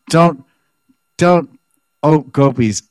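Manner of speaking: high, loud, forceful "don't"; fierce rebuke. Acted. Lalita rebuking the envious gopis in a high loud voice. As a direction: shout them down.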